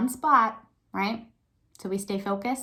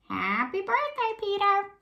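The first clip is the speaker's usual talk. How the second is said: much higher, slow squawky parrot copy; parrot echo; 13 semitones higher, slower than usual. Acted. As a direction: mimic it like a parrot repeating. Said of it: "repeated the parrot".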